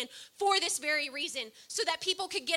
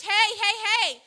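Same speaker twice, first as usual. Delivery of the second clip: loud, higher calling out; retold shouting; 4 semitones higher, 8 dB louder than usual. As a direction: call out in alarm down the hall. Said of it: "nurses screaming".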